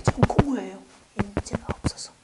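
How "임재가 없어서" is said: hushed whisper; whispered reply; whispered answer. Acted. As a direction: let the answer drop to a whisper. Answering own question in a hush.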